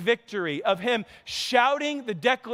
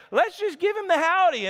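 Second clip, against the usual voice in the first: Jed voice; 8 semitones higher than usual. Retold or imitated. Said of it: "He said".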